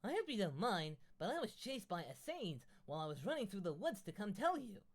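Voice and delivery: put-on high, loud girlish rush of words; lightly voiced excited girl blurting it all out; a hint of a frantic young girl; breathless girl voice. Enid rambling after chase.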